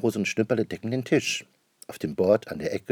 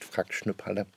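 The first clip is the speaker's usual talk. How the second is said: ordinary delivery, nothing marked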